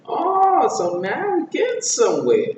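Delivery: high, loud exclamation, easing down; excitable high-voiced sidekick; Donkey. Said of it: "Donkey goes out and asks Shrek".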